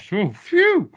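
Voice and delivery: mock relieved exhale. Mock relief after friendship test.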